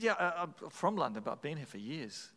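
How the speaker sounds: ordinary delivery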